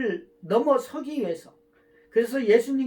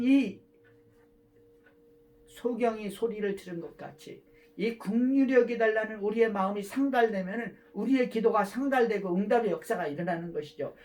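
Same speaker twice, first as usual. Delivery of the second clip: ordinary delivery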